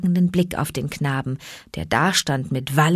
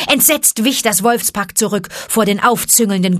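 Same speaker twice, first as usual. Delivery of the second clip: plain reading voice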